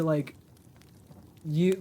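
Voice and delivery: ordinary talk, nothing marked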